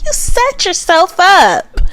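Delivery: high, slow teasing scold. Real, not acted. Scolding Chelsea for the Megan Fox claim, high teasing. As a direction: drag it out mockingly. Repeated line, first higher.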